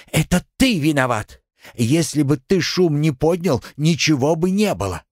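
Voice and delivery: put-on angry girl. A narrator's reading. Girl blames Artyom.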